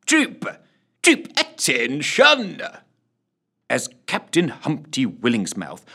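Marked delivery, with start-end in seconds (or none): 0.1-2.6 high, drawn-out drill call; parade-ground command. A narrator's reading. Sergeant calling troop to attention as captain enters, commanding. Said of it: "he was obliged to utter".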